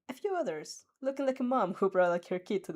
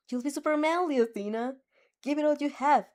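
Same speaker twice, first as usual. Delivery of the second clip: a touch higher and brighter, encouraging; lightly voiced cheerful friend; 6 semitones higher, 4 dB louder than usual. A narrator's reading. Kirishima encouraging Athena before her fight, lightly upbeat.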